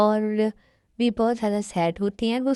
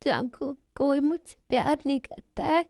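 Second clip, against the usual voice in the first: high, tearful self-pity; acted weepy voice; crying; 3 semitones higher than usual. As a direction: lament it through sobs. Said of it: "अब क्यों रो रही हो".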